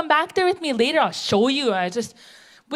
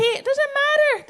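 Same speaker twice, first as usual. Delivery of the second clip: indignant high exclaim; 12 semitones higher, slower than usual. Acted. Indignant about taxes, high.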